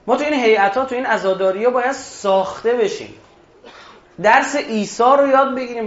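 loud, high, impassioned sermon voice; preaching at full force. Acted. Preacher urging mourners to reform themselves, loud and impassioned. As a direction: preach it out to the whole hall.

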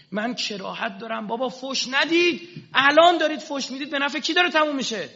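high, loud impassioned plea; fervent shouted sermon climax; preaching shout. Acted. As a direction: cry out the warning to the crowd. Preacher shouting Ali's warning.

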